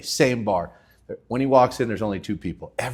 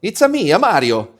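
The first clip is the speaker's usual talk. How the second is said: Mario impression; 8 semitones higher, 7 dB louder than usual.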